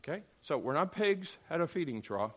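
normal talking voice

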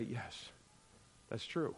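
quiet, sincere yes; soft agreement. Retold or imitated. Believer hearing the scriptures and softly agreeing. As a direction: concede it softly and simply.

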